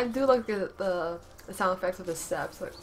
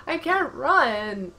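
loud slow wail of panic; panicked shout; 5 semitones higher, 10 dB louder, much slower than usual. Real, not acted. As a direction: cry it out stretched and loud. Panicked in game.

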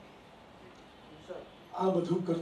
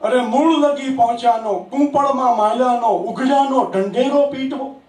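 raised, sing-song reciting voice; poetry recitation; 6 semitones higher, 10 dB louder than usual. Acted. Poet reciting his verse to an applauding audience, raised and sing-song. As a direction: declaim each line to the hall, savouring it.

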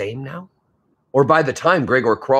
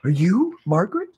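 puzzled; 8 semitones higher than usual. Acted. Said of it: "said his dad".

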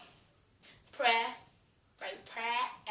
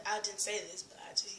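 usual speaking voice

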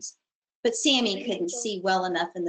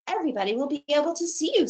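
fast; 5 semitones higher, much faster than usual. Acted. Sammy worried about ptarmigan.

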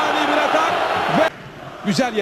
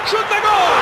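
screaming football commentator; 7 semitones higher than usual. Acted. Goal call.

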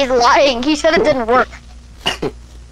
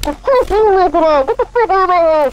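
slow, higher villain growl; 5 semitones higher, much slower than usual. Acted. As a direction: play the menacing villain, slowly. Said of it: "speak like a villain".

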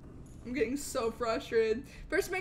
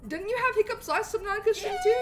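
reading chat aloud; 7 semitones higher, 6 dB louder, faster than usual. Pretend.

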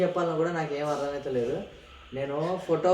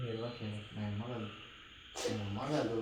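tearful; 7 semitones lower, 14 dB quieter than usual. Real, not acted. Wife sobbing over her mother.

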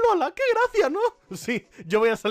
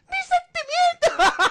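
high, slow hammed-up cry; mock wounded wail; 6 dB louder, much slower than usual. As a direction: drag out a squeal of hurt feelings. Hurt Pokémon reply, acted.

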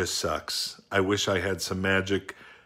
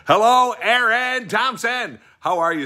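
high, loud, drawn-out hello; a grand, slow hello sung upward; big greeting; 13 semitones higher, 8 dB louder, slower than usual. Real, not acted. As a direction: stretch the hello out slowly, way up high. Opens personal greeting.

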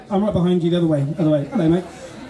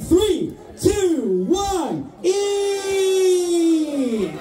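shouted countdown; 8 semitones higher, much slower than usual. Real, not acted. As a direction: call the start like a race starter. Host starts chili-eating countdown.

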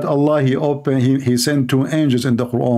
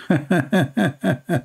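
laughing; 4 semitones higher than usual. Real, not acted. Laughs at own joke.

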